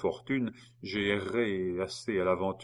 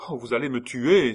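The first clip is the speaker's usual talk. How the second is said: louder, higher terrified cry; cried out; 4 semitones higher, 7 dB louder than usual. A narrator's reading. Geneviève crying out to Dixmer in fear. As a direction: cry it out in alarm. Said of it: "s'écria Geneviève".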